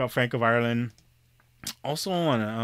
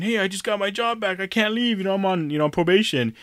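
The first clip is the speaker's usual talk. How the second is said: higher, lightly voiced Doofus; retelling the show's friend, higher mock voice; imitating Doofus; 7 semitones higher than usual. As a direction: put on a goofy higher voice for the slacker friend. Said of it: "Doofus is saying".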